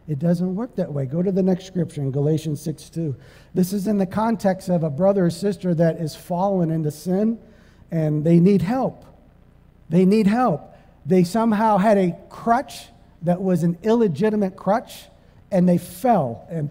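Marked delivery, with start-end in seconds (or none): none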